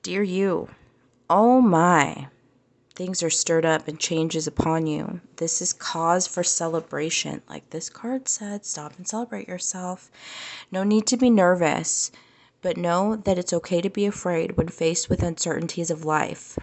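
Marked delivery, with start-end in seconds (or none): 0.0-7.4 louder, upbeat reading of the card; proclaiming a card's message. Acted. Reads the card's message aloud. 8.7-16.6 louder, upbeat reading of the card; proclaiming a card's message. Acted. Reads the card's message aloud.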